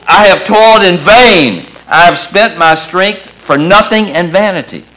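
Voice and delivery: loud, high cry from the pulpit; preached outcry of wasted labor; impassioned lament. Acted. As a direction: cry out the complaint with full force. Preacher voices the Messiah's lament.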